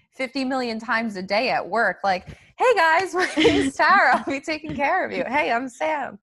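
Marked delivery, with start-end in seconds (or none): 2.6-6.2 high, bright fake-friendly greeting; chirpy waitress hello, pitched way up; customer service voice. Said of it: "In your fake-ass customer service voice".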